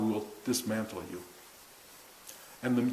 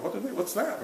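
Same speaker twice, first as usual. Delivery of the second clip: high, hushed puzzled aside; mock bafflement; 8 semitones higher, 5 dB quieter than usual. Newcomer baffled by people at a fire ceremony, bewildered. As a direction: wonder under your breath, pitched up.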